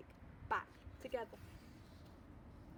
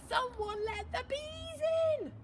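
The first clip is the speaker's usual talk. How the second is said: dramatic reveal; 12 semitones higher, 6 dB louder than usual. A narrator's reading. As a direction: stretch it out in mock alarm.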